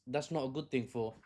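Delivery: ordinary talk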